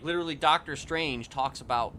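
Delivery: ordinary talk, nothing marked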